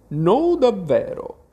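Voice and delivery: high, loud, drawn-out indignant no; offended refusal. Acted. Offended mouse refuses and leaves. (rifiuto indignato)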